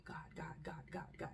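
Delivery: soft unvoiced repeated plea; mother whispering to God while fleeing; whispered frantic prayer. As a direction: pray under your breath in panic. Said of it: "saying it to myself".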